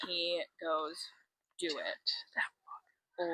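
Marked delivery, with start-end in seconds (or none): 1.6-3.3 whispered, reluctant go-ahead; hushed order. Retold or imitated. Knight letting them medicate her, hushed order. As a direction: act out giving consent under the breath.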